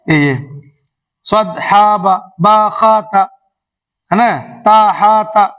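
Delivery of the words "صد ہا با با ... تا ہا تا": each letter "با خا تا" clipped with a jolt; mock choppy spelling; jerky reading. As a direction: read "تا ہا تا" choppily like the kids. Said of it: "جھٹکا لگا کے پڑھتے ہیں".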